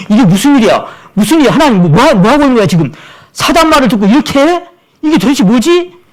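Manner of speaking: high, loud, indignant disbelief; 놀라서 외치듯 높고 크게; incredulous outburst. Retold or imitated. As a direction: cry out in shock at God. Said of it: "막 이렇게 하는데".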